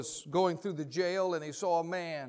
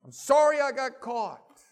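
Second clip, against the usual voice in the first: exasperated prisoner; 10 semitones higher, 8 dB louder than usual. Retold or imitated. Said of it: "He said".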